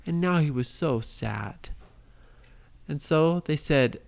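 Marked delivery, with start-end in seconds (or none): none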